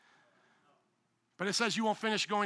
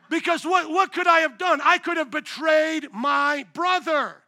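much higher and louder, last line slow and weighty; impassioned outburst; 6 semitones higher, 8 dB louder, slower than usual. Real, not acted. Pastor pleading with congregation, loud impassioned climax. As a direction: build to a passionate cry.

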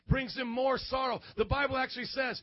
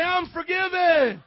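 bigger, higher, drawn-out rallying shout; wide-awake shout; 6 semitones higher, 10 dB louder, slower than usual. Shown as a demonstration. Preacher urges a sleepy church to say it, full wide-awake shout. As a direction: belt it out to wake the congregation. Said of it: "say it like you're up this morning".

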